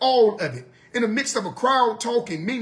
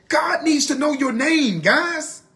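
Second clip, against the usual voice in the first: normal talking voice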